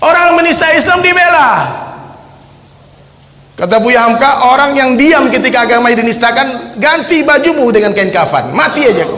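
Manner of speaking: fiery preaching. Acted. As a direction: rouse the crowd with rising anger.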